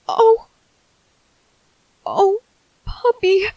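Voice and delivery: choking up with a sob; starting to cry. Acted. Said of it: "I'm crying because".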